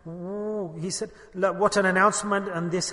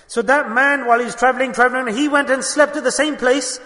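raised, louder preaching voice; 6 semitones higher, 7 dB louder than usual. Acted. Imam building the story to his listeners, fervent preaching. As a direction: swell into a fervent sermon climax.

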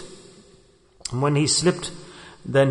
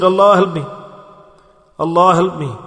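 raised, louder call of a man in danger; pleading cry for help; 3 semitones higher, 4 dB louder than usual. Retold or imitated. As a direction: cry out to God from the cliff edge. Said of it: "he shouts out".

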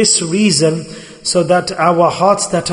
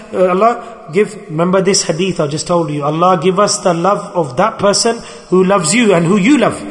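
ordinary talk

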